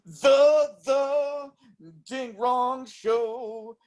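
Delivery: high, slow drawn-out show intro; show announcer. Acted. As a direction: announce the show title grandly. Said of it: "let me go".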